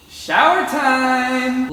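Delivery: loud, high, drawn-out shout; sing-song shower call. Shown as a demonstration. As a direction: announce it like a daily ritual. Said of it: "That's what I say every time".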